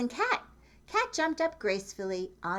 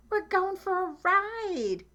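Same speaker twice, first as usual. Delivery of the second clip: much higher, slow and excited; Dog thinking gleefully, higher and slower; Dog's happy thought; 12 semitones higher, 4 dB louder, slower than usual. Acted. Dog thinking about the tractor ride, high and excited. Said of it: "he thought".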